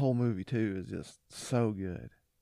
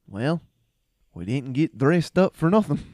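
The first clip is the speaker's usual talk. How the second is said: mock heroic loud delivery; Braveheart line, loud; 3 semitones higher, 8 dB louder than usual. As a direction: boom it out like a warrior shrugging at death. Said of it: "like that".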